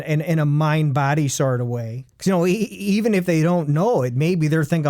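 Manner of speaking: normal talking voice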